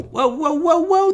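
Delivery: high-pitched run of startled whoas; taken aback. Real, not acted. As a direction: halt yourself in sudden surprise. Startled by wrong screen.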